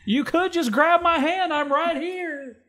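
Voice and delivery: put-on high pleading little boy; high kid voice. Johnny voiced in skit.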